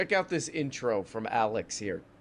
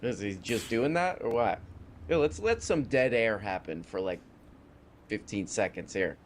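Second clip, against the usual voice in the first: ordinary talk, nothing marked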